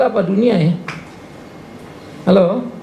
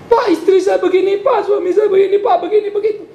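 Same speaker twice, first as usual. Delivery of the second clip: much higher, whiny voice with laughter; mimicking whiny complaints; 10 semitones higher than usual. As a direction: whine like a fussy complainer. (meniru jemaat mengeluh dengan suara tinggi merengek)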